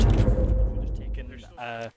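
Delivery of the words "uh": normal talking voice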